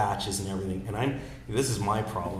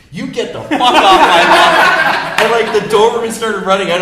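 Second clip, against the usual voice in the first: laughing mock yell; 14 semitones higher, 21 dB louder than usual. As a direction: shout her threat while cracking up. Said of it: "She goes".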